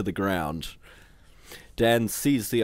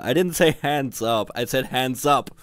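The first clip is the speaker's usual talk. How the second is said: loud, higher-pitched scolding; exasperated cry; 5 semitones higher, 5 dB louder than usual. A narrator's reading. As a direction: cry out at idiot troops. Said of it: "cried Visor".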